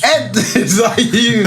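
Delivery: high, slow, laughing call; playful squeal of recognition; mock surprised. Mock calling out to [name].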